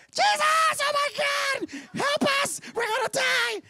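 very high, hammed-up screaming disciples; panicked scream. Terrified disciples screaming to wake Jesus in the storm, panicked mock shriek. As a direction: wail for help in mock terror.

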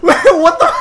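squealing laugh cut by a startled what the; high giggling shock; surprised laugh. Real, not acted. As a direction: burst out laughing in disbelief. Surprised by chaos in game.

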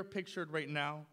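normal talking voice

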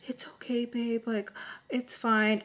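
soft, slowed, cooing reassurance; imitated soothing boyfriend. Boyfriend comforts her on the phone, soft imitated reassurance. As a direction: coo it softly, taking your time.

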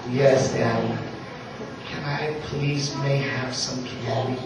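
low, soft imitation of a pleading daughter; sweet-talking little girl. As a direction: lightly play the child asking ever so politely. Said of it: "got the little eyelashes".

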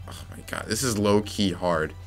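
ordinary talk, nothing marked

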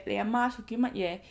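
ordinary delivery

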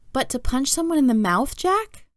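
appalled mom, voice going up. A narrator's reading. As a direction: pitch climbing into disbelief. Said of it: "raising her voice".

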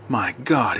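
breathless shocked whisper; gasped whisper. A narrator's reading. Chief seeing the dead woman, gasped whisper. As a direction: let it escape on a breath. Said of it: "he gasped".

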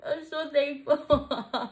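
slow, high and choked up; overwhelmed with thanks, on the edge of sobbing; real happy crying, drawn out; tearful gratitude. Real, not acted. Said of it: "I'm gonna cry".

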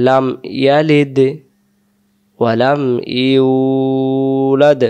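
slow, drawn-out chanted recitation; Quran recitation. Shown as a demonstration. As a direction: intone each word slowly and melodically. Continues recitation demo.

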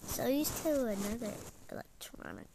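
normal talking voice

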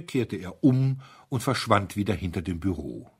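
ordinary delivery

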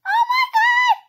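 very high, loud shriek; terrified scream. Real, not acted. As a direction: scream at the sudden scare. Jump scare.

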